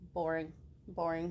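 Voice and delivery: low, flat "Boring"; dull dismissal. Acted. Potato dismissing the girl, low and dull. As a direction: brush it off. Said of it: "potato dismisses".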